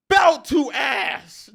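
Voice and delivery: loud, drawn-out battle cry; hyped shout. Real, not acted. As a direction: holler it like a slogan, slow and high. Hyped slogan about a beating.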